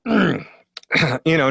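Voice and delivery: usual speaking voice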